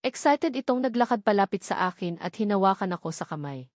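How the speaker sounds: ordinary delivery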